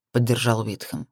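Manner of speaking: usual speaking voice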